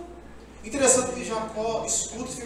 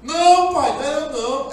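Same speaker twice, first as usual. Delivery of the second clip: loud, higher protest; 8 semitones higher, 8 dB louder than usual. Retold or imitated. Esaú denying to his father, loud. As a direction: cry out in protest. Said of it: "Esaú protesting".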